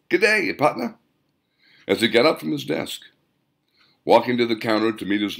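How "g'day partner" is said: much higher put-on Western drawl greeting; a forced folksy cowboy hello; dialect not his own. Shopkeeper greeting a stranger in a put-on frontier accent. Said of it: "say in a dialect not his own".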